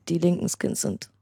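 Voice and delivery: ordinary talk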